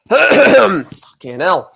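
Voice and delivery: slow, croaking curse while choking. Real, not acted. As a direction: force it out through a choke. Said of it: "Fucking choking on a fucking plain breadstick".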